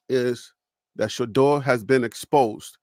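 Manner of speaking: ordinary delivery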